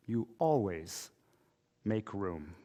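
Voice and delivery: hushed, heartfelt closing line; soft conclusion. Real, not acted. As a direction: let the lesson land gently. Closing the sermon with mom's words.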